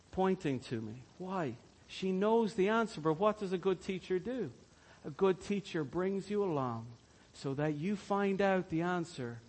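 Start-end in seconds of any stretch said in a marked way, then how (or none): none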